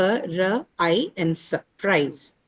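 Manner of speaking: ordinary talk, nothing marked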